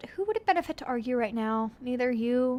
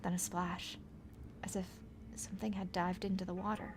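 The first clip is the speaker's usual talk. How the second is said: whispering; 3 semitones lower, 9 dB quieter than usual. A narrator's reading. Suspense narration.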